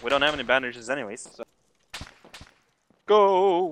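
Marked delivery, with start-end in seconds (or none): 3.1-3.7 shouting. Real, not acted. Urging teammate to run.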